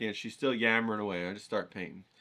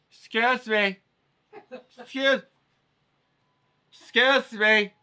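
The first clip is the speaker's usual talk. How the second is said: higher, louder, insistent lady interrupting; pushy lady; 11 semitones higher, 7 dB louder, slower than usual. Retold or imitated. Turbaned panhandler lady calling out to the painter, insistent and higher. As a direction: tap a stranger and demand attention, lady voice. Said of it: "And it's this lady, right?".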